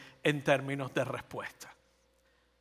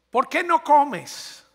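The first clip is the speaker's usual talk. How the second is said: higher, louder, very slow puzzled husband; clueless husband; 8 semitones higher, 5 dB louder, much slower than usual. Acted. Husband asking his weeping wife why she won't eat, puzzled and slow. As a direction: ask it slowly, baffled and higher.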